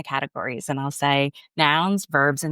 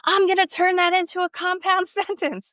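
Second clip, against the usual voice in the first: put-on child's voice. Kid voiced in a story.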